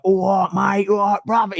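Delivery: lightly done London Cockney greeting; playful Cockney lad voice; Cockney impression. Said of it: "the most Cockney man in the whole world".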